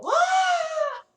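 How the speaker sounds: sudden high pained scream of a falling man; piercing yelp of a sailor blown off the rigging. Acted. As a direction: cry out as the blast hits you. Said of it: "you actually hear one guy go".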